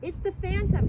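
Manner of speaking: high, loud shout of the Phantom; excited scream. A narrator's reading. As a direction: cry it out at last, high and loud. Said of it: "he screamed at last".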